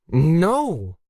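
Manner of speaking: a strained, choked no; choked high no. A narrator's reading. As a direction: force the word out through a tight throat. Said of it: "Zoe choked out".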